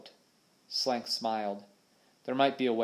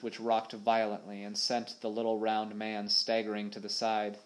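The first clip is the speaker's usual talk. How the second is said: usual speaking voice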